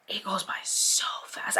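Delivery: hushed whisper, close to the mic; soft confiding whisper. Real, not acted. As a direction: whisper it like a secret to a tired mom. Intimate whisper of the refrain.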